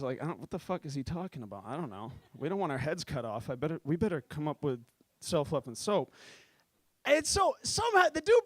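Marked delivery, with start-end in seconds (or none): 0.2-6.1 low, soft worried advisor; a touch of a frightened advisor; mild nervous mutter. Retold or imitated. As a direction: mutter it so the sheik won't hear. Panicked advisor mutters to others.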